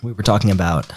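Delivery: usual speaking voice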